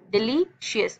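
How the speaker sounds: "Delicious" parted into डि-ली, शियस; syllable by syllable. Shown as a demonstration. Teacher showing breaths to pupils, syllable by syllable "delicious". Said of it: "तीन सांसों में पढ़ रहे हो".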